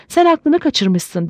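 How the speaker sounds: high, drawn-out exclamation without real shouting; mild exclaimed cry. A narrator's reading. Wife answers her raving husband, a restrained high-pitched cry. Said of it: "diye haykırdı karısı".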